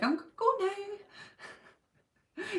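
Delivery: high, slow, whimpery little voice; mock timid exit. Meek person slipping away from a confrontation, high and whimpery. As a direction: squeak it out like fleeing a fight. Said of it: "I'm always like".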